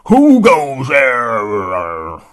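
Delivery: put-on loud, slow, booming old hound; old dog's challenge. Old hound dog calling out at the unseen intruder, booming and slow. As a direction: call out into the dark, very slow.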